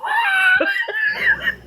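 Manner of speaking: shrieking burst of laughter. Real, not acted. Laughs at a dirty joke.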